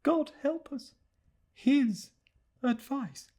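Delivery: high, hushed lament, leaning on His then falling on advice; despairing murmur to herself. Acted. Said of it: "she said to herself".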